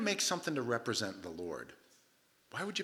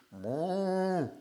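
a higher mock moo; cow moo; 6 semitones higher, 3 dB louder than usual. Preacher imitates a cow asking for food, with a mock moo.

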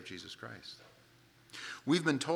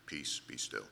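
deep, quiet commanding hush; 11 semitones lower, 9 dB quieter, slower than usual. Shown as a demonstration. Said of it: "That's not what he said".

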